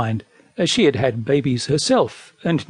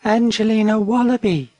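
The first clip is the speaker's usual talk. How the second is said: high, gentle calling voice; dainty little wallaby; soft-voiced wallaby; 6 semitones higher than usual. A narrator's reading. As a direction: call the name up sweetly in a high voice. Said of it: "called a very soft voice".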